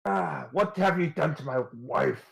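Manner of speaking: rising to an outraged high cry; angry outburst. Acted. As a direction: burst in furious and accusing. Husband NPC bursts in.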